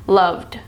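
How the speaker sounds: "loved" ending in a voiced "d". Shown as a demonstration. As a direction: end "loved" on a voiced "d" with no air. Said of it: "goes to the back of your throat".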